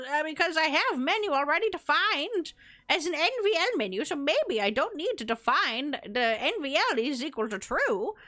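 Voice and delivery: wavering, sing-song grandma voice; granny voice. Pretend. Streamer grumbles at her broken code as a granny, put-on old-lady voice. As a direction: talk like a put-on granny, pitch swooping up and down. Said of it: "I guess I'm talking like a granny".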